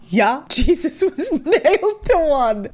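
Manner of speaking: imitating a cocksure boyfriend, laughing through it; loud, slow, hammed-up certainty; mock confident. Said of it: "so confidently and said".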